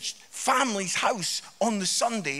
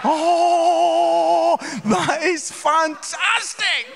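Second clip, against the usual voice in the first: loud gleeful cry, much higher; delighted outburst; 7 semitones higher, 8 dB louder, much slower than usual. Real, not acted. Comedian loudly savours the dog-gravy punchline.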